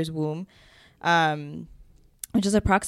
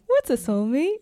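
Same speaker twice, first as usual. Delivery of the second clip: creepy TikTok voice; 7 semitones higher than usual. Retold or imitated. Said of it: "With the weird, creepy voice?".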